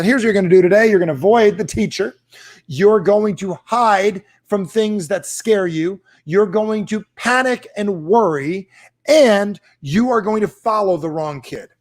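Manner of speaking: mock parent advice.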